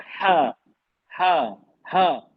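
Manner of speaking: हा pushed from the throat, each one separate and falling; isolated, breath-driven हा, repeated to show its origin; throat articulation. Shown as a demonstration. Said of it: "ये हमारे गले से निकल रही है".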